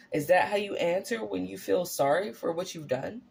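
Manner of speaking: ordinary delivery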